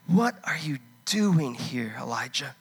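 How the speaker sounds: slow, gentle voice of God asking; lightly voiced God, slow and probing; God's voice. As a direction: ask it slowly, searching. God questions Elijah in cave.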